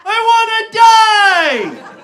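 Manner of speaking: high, loud shriek, drawn out; screaming. Retold or imitated. After the turd touches his lip, screaming. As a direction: scream in disgust. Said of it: "I screamed".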